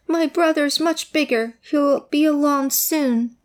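high, eager little goat. Acted. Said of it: "said the goat".

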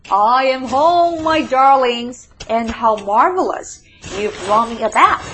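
loud, stretched homecoming greeting; delighted calling out. A narrator's reading. Mole thanking family for a bath, delighted. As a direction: gush loudly to your darlings.

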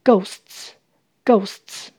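ghosts ending in a hissed "ts", silent H; final TS sound. Shown as a demonstration. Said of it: "T S 发呲的声音，H不发音".

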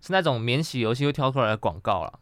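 ordinary talk

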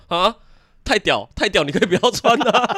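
high, loud, laughing sergeant; squad leader. Retold or imitated. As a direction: bark back at the recruit while cracking up. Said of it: "然后班长". (边笑边高声学班长)

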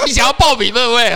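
teasing laugh. Real, not acted. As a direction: needle your friend through laughter. Teasing co-host about signing up.

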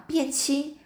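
a startled, high-pitched question; surprised. A narrator's reading. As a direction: sound amazed at the idea. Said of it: "爱美就很惊讶".

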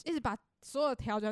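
usual speaking voice

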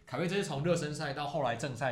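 ordinary delivery

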